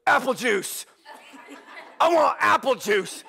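put-on little girl demanding juice at dawn; demanding toddler. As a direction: bark it like a bossy toddler. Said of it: "She goes".

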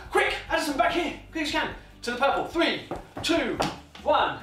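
high, hurrying countdown; urgent calling. Real, not acted. Coach hurries toddler back on camera, high urgent call.

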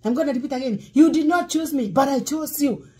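ordinary delivery

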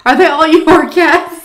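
high, loud, drawn-out question; dumb question. Retold or imitated. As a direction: ask the silly question with a big rising end. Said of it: "It was so dumb".

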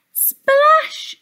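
high loud "Splash!"; comic splash. A narrator's reading. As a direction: burst it out high. Sound word read with effect.

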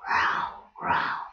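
breathy growling bear noises. Shown as a demonstration. Playing bears for the children, growl. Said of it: "the growly voice".

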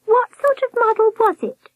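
very high warbling question; high-pitched nurse. A narrator's reading. Nurse asking Albert about his model, high warble. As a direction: inquire in a high falsetto. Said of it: "the high-pitched voice".

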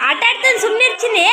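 ಸುಮ್ನೆ ಇರ್ತೀನಿ in a high mock child whine. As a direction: whine it like the upset little girl. Voicing the crying child's complaint.

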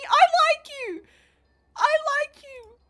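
very high, loud gushing; gushing squeal. Real, not acted. Streamer reassures a viewer, gushing squeal.

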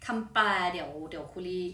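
ordinary talk, nothing marked

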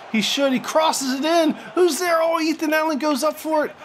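high, urgent run of commentary; excited play-by-play. Real, not acted. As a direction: call a goalmouth scramble, pitch held high. Attack in the box, live call.

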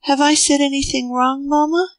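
put-on high, rising, worried little boy's question; anxious child. A narrator's reading. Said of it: "he asked anxiously".

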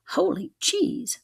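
high, slow, dismayed cry; shocked exclamation. Acted. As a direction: gasp it in disbelief. Dismay at nine tents.

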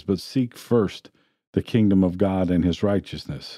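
usual speaking voice

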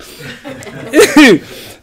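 high, loud burst of laughter; real leftover giggles breaking out; laughing. Real, not acted. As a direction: laugh at your own silliness. Laughs at own dumb remark.

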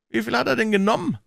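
high-pitched, prompt question; urgent, mildly brisk inquiry; quick question. A narrator's reading. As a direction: demand the figure without delay. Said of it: "fragte Stetland schnell".

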